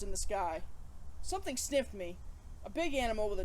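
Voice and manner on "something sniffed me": usual speaking voice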